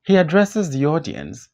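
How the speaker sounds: normal talking voice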